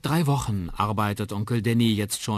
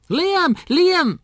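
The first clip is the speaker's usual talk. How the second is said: put-on very high, loud child-like calls; calling out; 12 dB louder than usual. Twins storm into the kitchen, calling out shrilly. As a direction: burst in calling like excited little twins. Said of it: "rufen sie".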